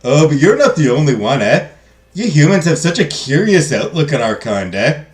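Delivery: broad, hammed-up Canadian accent. Godmother talks to the princess about humans, Canadian accent. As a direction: play it up as a Canadian, each "eh" tossed on the end. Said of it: "Can we get a Canadian accent?".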